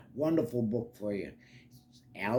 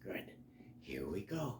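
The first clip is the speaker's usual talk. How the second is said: usual speaking voice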